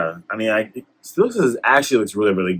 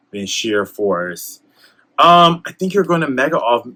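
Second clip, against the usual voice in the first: usual speaking voice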